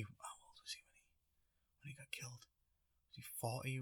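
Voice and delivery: very quiet mumble to yourself; near-whispered aside; thinking aloud. Real, not acted. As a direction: work it out under your breath. Thinking aloud, working out the age.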